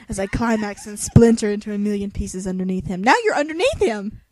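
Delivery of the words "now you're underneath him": amused exasperation; exasperated. Real, not acted. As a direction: point out the blunder loudly. Mocking the book's mistake.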